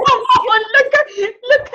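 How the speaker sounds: high laughing come on; laughing coax. Real, not acted. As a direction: laugh as you urge her on. Laughing coax to show her face.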